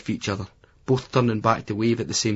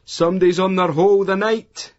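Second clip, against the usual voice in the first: higher, louder jeering yell; shouted from a van; 9 semitones higher, 6 dB louder than usual. A narrator's reading. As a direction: bawl it out of a passing van window. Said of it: "A guy leans out the window and shouts".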